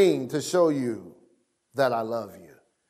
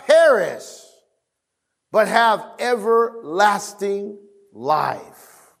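scripture reading; 4 semitones higher than usual. Acted. Reading the scripture verse aloud.

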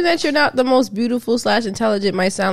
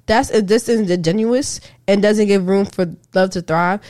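ordinary talk

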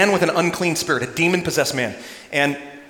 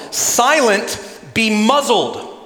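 louder, slower "Silent, be muzzled" with "muzzled" falling and drawn out; commanding the storm; 6 semitones higher, 4 dB louder, much slower than usual. Acted. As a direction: rebuke the storm, stretching out "muzzled". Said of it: "his words is to the storm".

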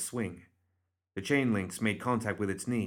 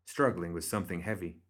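ordinary delivery, nothing marked

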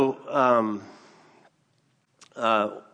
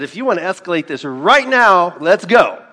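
loud, high confrontational challenge; retold challenge; 7 semitones higher, 14 dB louder than usual. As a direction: square up and dare him. Retold confrontation with angry man.

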